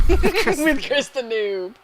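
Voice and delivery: loud, high laugh through the words. Real, not acted. Co-caster finishing the best friends joke, laughing. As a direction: crack up while finishing the joke, slowly.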